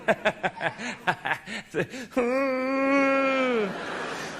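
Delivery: high fake laugh trailing into a long wail; mock hysterical laughter. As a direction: laugh as if at something hilarious, overdone. Mocks reading hilarious tech manual.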